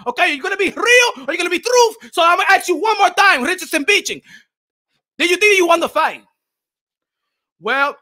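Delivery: loud, high-energy confrontational grilling; hammed-up angry post-fight interview; irate interviewer. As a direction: berate the boxer as a fed-up interviewer. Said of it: "I would've told him like that".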